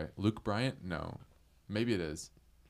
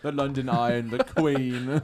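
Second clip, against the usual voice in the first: mock English accent; 6 semitones higher, 10 dB louder, slower than usual. Riffing on English stereotypes.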